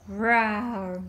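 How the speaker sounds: put-on playful roar for a toddler; lion roar. Parent answers lion with a roar.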